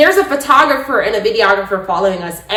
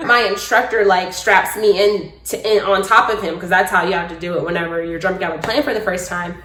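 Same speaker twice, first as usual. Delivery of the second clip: ordinary talk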